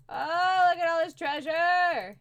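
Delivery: high, loud burst of delight; excited exclamation. Real, not acted. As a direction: cry out over found treasure, high and loud. Pirate treasure found in game.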